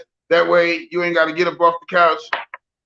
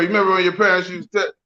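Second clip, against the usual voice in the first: ordinary talk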